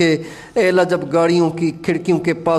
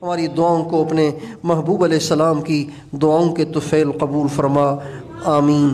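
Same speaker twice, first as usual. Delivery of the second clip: lower, subdued closing of a supplication; closing prayer; 5 semitones lower than usual. Real, not acted. A preacher asks that the prayers be accepted, closing low. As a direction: bring the plea down to a low amen.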